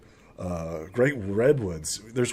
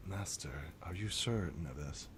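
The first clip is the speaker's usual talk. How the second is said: much softer, lower guard elf; quiet concern; 5 semitones lower, 16 dB quieter than usual. Acted. Guard elf quietly questioning his master. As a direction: ask it softly and respectfully.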